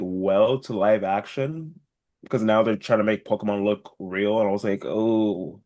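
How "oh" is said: normal talking voice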